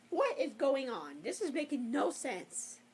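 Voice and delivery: ordinary talk, nothing marked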